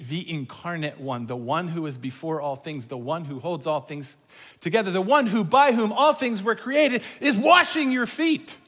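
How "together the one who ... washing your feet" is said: rising louder and higher to the peak; preaching crescendo, loud and high; building to a shout. Real, not acted. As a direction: build it up till you're almost shouting.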